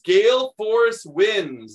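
plain reading voice

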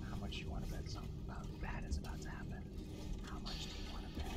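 fast, breathless whisper; whispered foreboding. Real, not acted. Player whispering to viewers that disaster is coming. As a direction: hush it like danger is listening. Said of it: "whispering foreboding".

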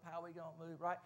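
usual speaking voice

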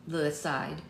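ordinary delivery

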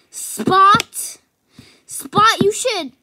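loud frustrated shout; scolding a carpet stain loudly; shouting at the spot. Real, not acted. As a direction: yell at the stain to obey. Yelling at the spot.

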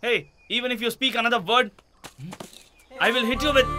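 angry threat. Acted. Man warns partner to stop talking, angry threat. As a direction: threaten sharply, at the end of patience.

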